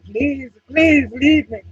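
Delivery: high, loud, slow whiny plea; mock begging. Mocking the crying man pleading to be left, high slow whine. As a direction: beg like a crying grown man.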